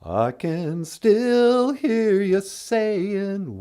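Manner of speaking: ordinary talk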